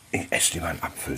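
softly repeating her complaint; quiet mock daughter. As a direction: mutter the quote under breath. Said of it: "sagt sie".

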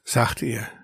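usual speaking voice

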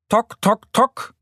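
high, staccato tapping imitation; sharp high knocks; woodpecker knocking. A narrator's reading. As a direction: tap out each syllable like a beak.

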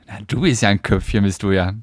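ordinary talk

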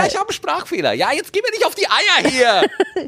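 squeaky annoyed whine; whiny high voice. Acted. Whining about speech defect.

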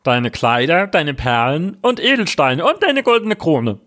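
high, put-on frog voice with quacks. Frog setting his terms to the princess, high creature voice. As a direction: bargain in a high frog voice, croaking each Quack. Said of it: "Der Frosch antwortete".

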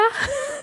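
short, high, quiet laugh; real soft giggle; amused laugh. Real, not acted. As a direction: let out a little laugh at your own slip. Laughs at own brand-name slip.

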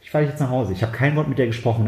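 ordinary talk, nothing marked

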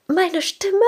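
put-on high, startled girl's question; squeaked disbelief; shocked Ariel. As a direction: Ariel gasping at the price. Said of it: "Und Ariel ist so".

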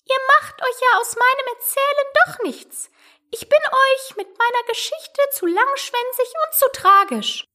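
very high-pitched mouse voice; sighing little mouse. A narrator's reading. Mouse complaining nobody values its story, high and sighing. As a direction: squeak it out plaintively as a tiny mouse. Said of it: "seufzte das Mäuslein".

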